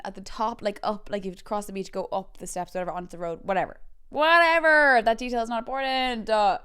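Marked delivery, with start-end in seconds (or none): 4.1-6.6 loud dismissal. Real, not acted. Storyteller scrapping a needless detail, loud exasperation. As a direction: cut off your own digression with a shout. Loudly dismisses own digression.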